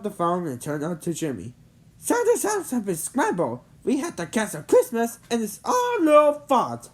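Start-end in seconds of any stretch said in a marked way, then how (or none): 2.0-6.9 rising high and louder, slowing at the end; angry blame. Acted. Head elf blaming Jimmy, mounting angry accusation. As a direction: pin the fault on him.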